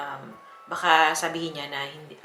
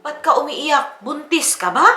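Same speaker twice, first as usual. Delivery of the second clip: father's question; 9 semitones higher, 9 dB louder than usual. Retold or imitated. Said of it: "Sabi ng tatay ko".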